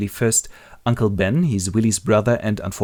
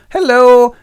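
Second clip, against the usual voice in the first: very high, loud hello; acted flirty call; 14 semitones higher, 10 dB louder than usual. The woman appears in Willie's memory, calling out. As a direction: trill a loud hello from afar.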